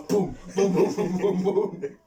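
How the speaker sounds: loud, high run of booms; imitated punches. Retelling a brawl to mate, loud mock punch noises. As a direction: act out the blows as rapid booms.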